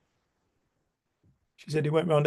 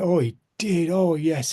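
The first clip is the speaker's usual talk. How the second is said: higher, louder, imitating a fond yes; emphatic agreement; 4 semitones higher, 4 dB louder, slower than usual. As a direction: agree emphatically, as a retold quote. Old lady's emphatic agreement, retold.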